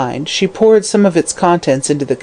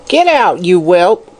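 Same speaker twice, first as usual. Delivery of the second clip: put-on croaking old hag; 2 semitones higher, slower than usual. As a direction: spit it at the child like a crone. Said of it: "croaked the old hag".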